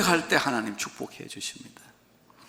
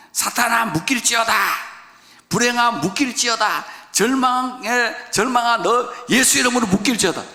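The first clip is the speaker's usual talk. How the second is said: loud, high binding declaration; commanding rebuke; 10 semitones higher, 6 dB louder than usual. Acted. Preacher demonstrating a rebuke to his congregation, ringing loud. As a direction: command each evil to be bound, at full force.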